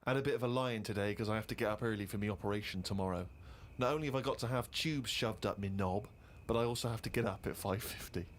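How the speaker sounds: reads the diary aloud, steady and a bit low; dry deadpan diary read; reading Carl's diary entry aloud to the listeners. Real, not acted.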